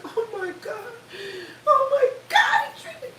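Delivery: high, wailing mock sobs; hammed-up tearful victim; fake crying. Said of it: "she'd be like".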